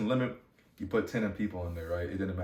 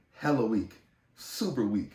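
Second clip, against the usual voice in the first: ordinary delivery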